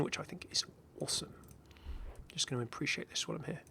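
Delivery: soft admiring whisper; whispered aside. Real, not acted. Sharing his opinion of the skin, whispered. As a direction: murmur it under the breath.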